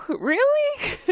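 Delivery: a high, laughing "really?"; disbelieving and amused; incredulous laugh. Real, not acted. Vlogger mocking the hiking commenter, laughing disbelief.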